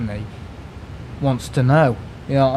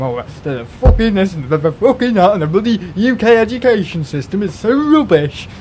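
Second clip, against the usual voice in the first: high, louder put-on rant; mock unfiltered rant; 6 semitones higher, 4 dB louder than usual. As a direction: act out the rant he didn't have. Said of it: "totally unfiltered and I was going".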